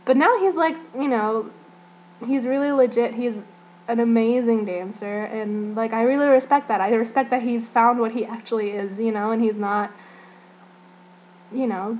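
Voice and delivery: usual speaking voice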